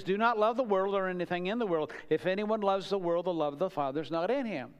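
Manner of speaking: brisk run through the familiar verse; quick rereading. Acted. Preacher rereading the verse quickly as a reminder.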